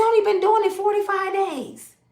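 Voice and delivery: high, loud panicked squeal; shocked squeal. Retold or imitated. Mother hearing her son's news, panicked high squeal. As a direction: shriek it like you're in trouble. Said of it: "I said".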